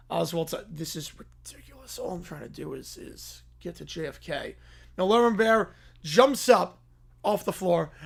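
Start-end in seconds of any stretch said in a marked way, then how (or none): 0.7-4.6 whispered, fed-up grumble under his breath; exasperated whisper. Acted. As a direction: hiss it to yourself, at the end of your rope. Oswald mutters to himself.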